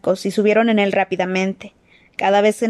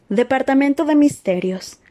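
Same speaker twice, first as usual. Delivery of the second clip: higher, detached lift announcement; announcer voice; 5 semitones higher than usual. Acted. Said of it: "anunció la voz femenina".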